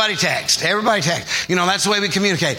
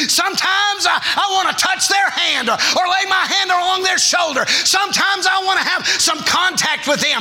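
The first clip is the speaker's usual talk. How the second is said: full-force preaching; 9 semitones higher than usual. Acted. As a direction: lift the congregation, preach it out.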